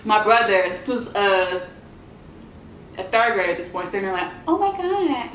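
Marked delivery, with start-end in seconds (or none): 4.5-5.3 imitating a little brother's high unbroken voice; high kid voice. Third-grade brother's high-pitched gasp. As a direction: squeak it out like a young boy. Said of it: "his voice hadn't broken yet".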